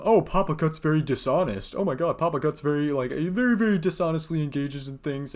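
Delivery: lightly voiced sneering critic; mocking critics. Streamer mimicking online critics, a light sneer. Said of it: "I saw people give the criticism".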